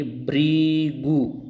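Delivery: "भृगु" very slow, bhreee-gu; drawn-out correct pronunciation. Shown as a demonstration. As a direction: stretch out bhri, then gu in "भृगु". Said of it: "भ्री बोलिए आप". ("भृगु" धीरे, 'भ्री' खींचकर)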